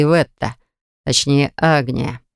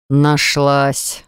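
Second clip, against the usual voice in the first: usual speaking voice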